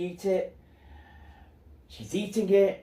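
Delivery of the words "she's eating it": normal talking voice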